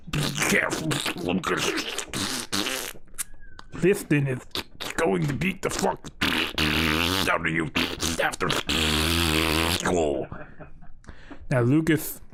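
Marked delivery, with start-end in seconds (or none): 0.1-3.0 butthole voice. Acted. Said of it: "his asshole screamed in Lucas's ear". 3.8-10.3 sinking down into a slow low growl on after school; butthole's warning voice. Acted. Talking butthole warning Lucas, dropping low and slow. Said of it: "his asshole screamed in Lucas's ear".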